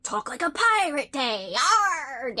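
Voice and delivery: hammed-up pirate growl. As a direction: play a cartoon pirate. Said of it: "great spoofs where people have done stuff like".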